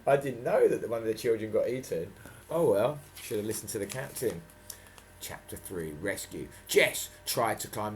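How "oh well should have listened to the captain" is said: plain reading voice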